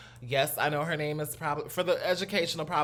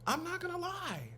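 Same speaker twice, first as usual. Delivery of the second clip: squeaky high; 7 dB quieter than usual. Real, not acted. Squeaky excited aside.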